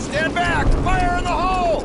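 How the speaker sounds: high urgent shouted warning. Acted. Demolition man warning squad of blast, urgent shout. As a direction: clear everyone off before the blast.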